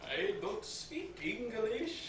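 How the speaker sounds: mock Dutch accent, high and slow; dumb foreign accent. Clerk refusing the customer in a mock Dutch accent. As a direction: ham up a clerk's broken English. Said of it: "in this really dumb accent".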